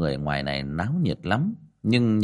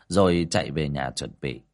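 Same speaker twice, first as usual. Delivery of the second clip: ordinary delivery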